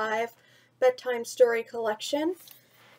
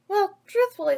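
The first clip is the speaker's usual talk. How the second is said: put-on higher and louder boy's voice; 7 semitones higher, 4 dB louder than usual. Mowgli admitting ants tickle, higher boy. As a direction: lift it as Mowgli. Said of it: "Mowgli said".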